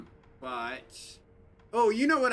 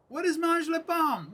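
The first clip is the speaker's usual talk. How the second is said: mock outrage; 11 semitones higher than usual.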